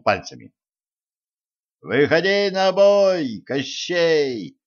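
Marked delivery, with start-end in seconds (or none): 1.8-4.6 high, drawn-out battle cry; shouted challenge. A narrator's reading. Prince challenges Кощей to combat, shouted. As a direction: call the foe out to fight. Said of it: "крикнул царевич".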